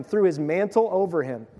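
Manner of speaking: ordinary delivery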